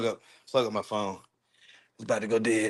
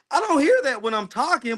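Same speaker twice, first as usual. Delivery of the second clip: high-pitched, baffled protest; incredulous; 11 semitones higher than usual. Real, not acted. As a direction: insist in real disbelief, voice pitched up. Shocked by own recorded voice.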